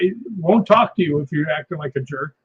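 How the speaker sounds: ordinary talk, nothing marked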